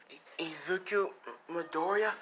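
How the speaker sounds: put-on high, hesitant little boy voice, stammering; a frightened child unsure of his own name; timid young boy. Scared boy gives his name.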